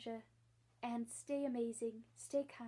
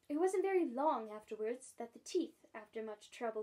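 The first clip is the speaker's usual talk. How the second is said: usual speaking voice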